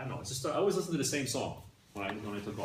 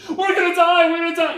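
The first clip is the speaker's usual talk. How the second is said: hammed-up panicked shout, much higher and louder; 18 dB louder than usual. Rich tourist stranded in the bush, hammed-up panicked shout. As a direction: yell it like you're sure you're doomed. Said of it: "The first guy's reaction was".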